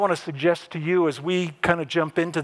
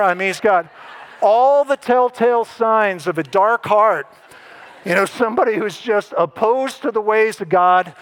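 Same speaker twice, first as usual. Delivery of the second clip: louder, building, mock-certain finger-pointing; mock accusation; 5 semitones higher, 5 dB louder than usual. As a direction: ham up the disciples condemning Judas. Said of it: "all eyes go to Judas, right, and go".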